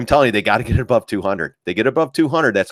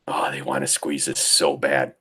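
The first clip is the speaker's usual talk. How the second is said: whispering; 7 semitones lower, 5 dB quieter than usual. Real, not acted. Aside while watching chart.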